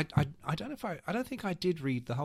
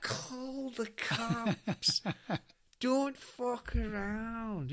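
slow, much higher Scottish accent imitation; 9 semitones higher, much slower than usual. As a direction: drawl it as the scared Scottish flatmate. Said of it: "I can't do the accent".

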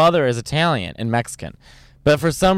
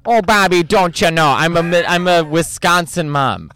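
mom from Bobby's World; 3 semitones higher than usual. Acted. Doing a cartoon mom after failing Irish, Wisconsin accent. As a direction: play a hammed-up Midwestern cartoon mother, Wisconsin accent. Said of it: "the mom from Bobby's World".

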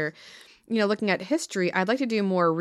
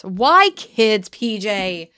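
loud, higher pleading question; 3 semitones higher, 9 dB louder, much slower than usual. Real, not acted. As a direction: press him for an answer. Repeated, second loud and far.

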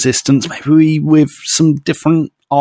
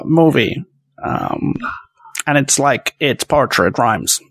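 George Lucas. Acted. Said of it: "That's my George".

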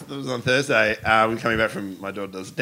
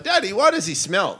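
put-on high-pitched child voice; little daughter; 7 semitones higher than usual. Daughter questioning her dad about a stranger, high childlike voice. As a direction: imitate a small girl asking her dad. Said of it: "she's like".

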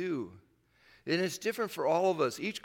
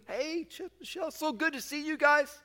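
higher, bright reunion hello; cheerful greeting; 12 semitones higher than usual. Retold or imitated. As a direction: greet long-lost friends with delight. Greeting old neighbours.

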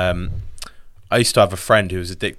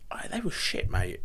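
whispering; 3 semitones lower, 12 dB quieter than usual. Real, not acted. Whispered aside.

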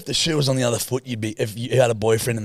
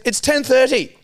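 higher, insistent reminder; bossy partner voice; 12 semitones higher, 3 dB louder, slower than usual. Retold or imitated. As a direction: point at the clock, insist. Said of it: "she goes".

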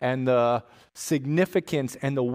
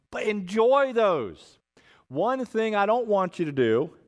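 mild voice of God, higher and unhurried; God speaking; 5 semitones higher than usual. Retold or imitated. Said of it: "Here's what God said".

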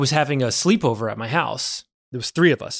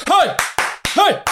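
sharp, high-pitched "hey"; short, high bursts of a startled father; startled dad's yell; 14 semitones higher than usual. Retold or imitated. Friend's dad surprising kids raiding the kitchen, sudden high yell. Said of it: "all of a sudden I hear this".

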